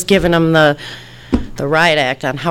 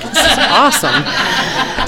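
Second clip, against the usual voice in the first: laughing slow delight; 8 semitones higher, much slower than usual. Retold or imitated. Retelling her reaction, laughing.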